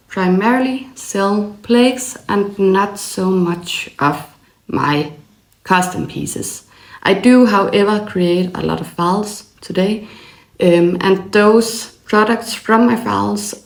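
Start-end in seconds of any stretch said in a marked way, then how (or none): none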